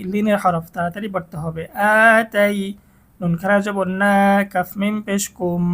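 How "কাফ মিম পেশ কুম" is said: ordinary talk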